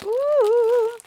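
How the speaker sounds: high, loud squealed "ooh"; delighted ooh. Real, not acted. Delight at the sticker.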